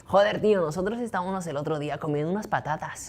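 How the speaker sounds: put-on quick, teasing Castilian Spain accent; mock Spain accent. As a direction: poke fun at Spain Spanish, laughing slightly. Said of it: "they say we speak like this".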